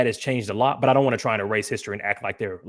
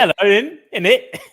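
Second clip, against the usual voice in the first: higher, louder cartoon British accent; mock British accent; 10 semitones higher, 8 dB louder than usual. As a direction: chime in with a hammed-up British accent. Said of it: "you see him in the interview".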